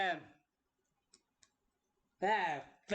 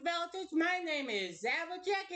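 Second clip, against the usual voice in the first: higher, put-on country accent; Applejack; 7 semitones higher than usual. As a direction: lift it into a cowgirl accent. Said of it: "I fucking lost the accent".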